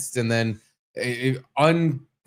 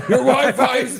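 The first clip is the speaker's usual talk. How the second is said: laughing mock yell; 12 semitones higher, 6 dB louder than usual. Mocking Wi-Fi complainer.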